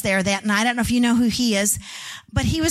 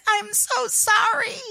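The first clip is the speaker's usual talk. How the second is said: higher, slow, choked apology through tears; a son crying "I'm so sorry" to his parents on the phone; 11 semitones higher, slower than usual. Retold or imitated. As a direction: let the voice break with sorrow. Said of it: "he started crying".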